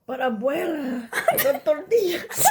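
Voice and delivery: high hammed-up telenovela plea. Sister parodies the film to her sibling, high mock pleading. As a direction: whine it up high, laughing. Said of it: "this is giving".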